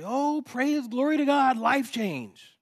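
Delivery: high, exultant mock hallelujah; mock praise shout. Preacher imagines Jonah celebrating instant results, mock-exultant. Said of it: "sit back and say".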